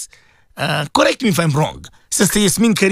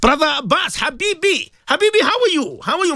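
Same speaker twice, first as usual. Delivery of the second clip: excited greeting; 10 semitones higher than usual. Real, not acted. Greets a caller on air.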